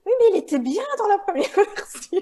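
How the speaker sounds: high, loud laughing insistence; insist, cracking up. Retold or imitated. Retold insistent co-author. (insistance moqueuse en riant)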